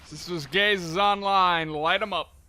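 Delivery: slow, loud hyped callout; excited callout. Real, not acted. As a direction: announce it pumped. Calling ability online in game.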